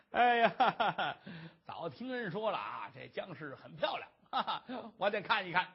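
laughing through the words; gloating villain. Acted. Gang boss eager to see the famed beauty, chuckling. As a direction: relish it with bursts of stage laughter.

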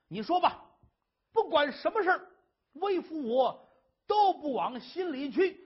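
bragging husband. Acted. Husband bragging to his wife he takes nothing to heart, swaggering. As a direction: build up to a proud boast.

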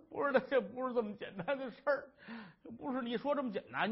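fast, urgent protest; insistent wife. Acted. Wife insisting to her husband it is serious, fast and urgent. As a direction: hurry to correct him.

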